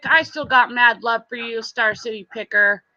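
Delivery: normal talking voice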